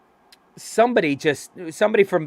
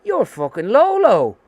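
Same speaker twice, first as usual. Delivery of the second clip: put-on older fan greeting; 7 semitones higher, 4 dB louder, slower than usual. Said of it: "and he goes".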